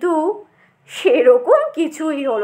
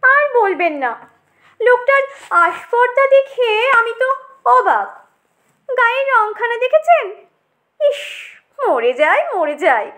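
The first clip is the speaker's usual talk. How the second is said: put-on affected, snobbish Bengali accent, pitched up; more twisted Bengali; 6 semitones higher than usual. Tomato gossips about the brinjal's nerve, in affected Bengali accent. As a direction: put on airs with bent Bengali pronunciation. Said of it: "আরও একটু বাঁকা করে".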